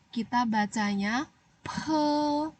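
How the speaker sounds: pe with a strong puff of air, slow; aspirated pe. Shown as a demonstration. Teacher shows pupils the pe with air. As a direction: breathe out hard on pe. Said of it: "Ada angin ya".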